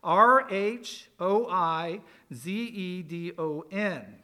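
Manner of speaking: slow, letter by letter; measured letter-by-letter spelling. Shown as a demonstration. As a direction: spell it slowly for the class, each letter separate. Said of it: "how you would spell it".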